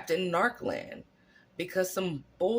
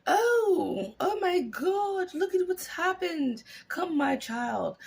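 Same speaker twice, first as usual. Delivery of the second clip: high, sugary mock good-witch voice; Glinda imitation; 12 semitones higher, slower than usual. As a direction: play a syrupy fairy godmother, much higher. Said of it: "And goes".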